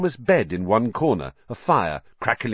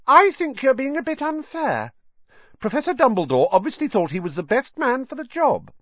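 much higher, primly defensive; much higher, prim protest; higher than usual. Acted. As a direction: stand up for the teacher, voice rising. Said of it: "in a voice somewhat higher than usual".

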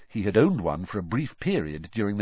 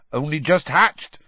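proudly announcing, voice lifted and louder; proud keeper showing off his creatures; 7 semitones higher, 5 dB louder than usual. A narrator's reading. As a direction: show them off with pride. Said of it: "said Hagrid proudly".

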